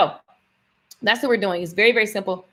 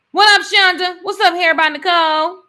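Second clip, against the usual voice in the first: high, loud greeting to the chat; hyped host shouting out her viewers; excited shout-out; 12 semitones higher, 11 dB louder than usual. Real, not acted. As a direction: call out to viewers like a host hyping the room. Greeting live viewers by name.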